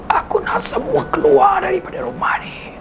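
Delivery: harsh whispered shout; spirit's hissed threat. Acted. Spirit threatening the family, a harsh whispered hiss. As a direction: hiss the threat like a vengeful ghost.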